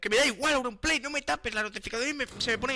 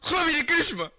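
loud, high outburst; startled shout; 8 semitones higher, 7 dB louder, slower than usual. Real, not acted. Player jumpscared by Javi, startled shout. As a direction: cry out the name in alarm. Crying out after a game scare.

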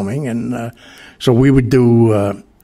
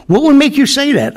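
scolding dad; 5 dB louder than usual. Retold or imitated. Father scolding retold.

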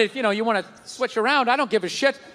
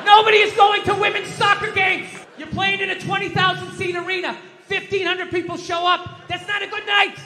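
ranting shout; 5 semitones higher than usual. Real, not acted. Comic ranting at the TV about empty soccer seats, shouting. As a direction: yell it like you're screaming at the TV.

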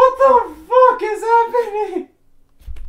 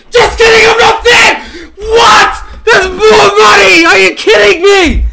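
excited shouting; 3 semitones higher, 7 dB louder than usual. Real, not acted. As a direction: shout with sudden joy. New donation arrives.

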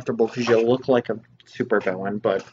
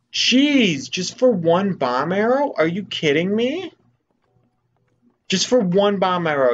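outraged disbelief; 7 semitones higher, 4 dB louder than usual. Real, not acted. Outraged at arrow price.

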